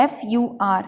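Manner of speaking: normal talking voice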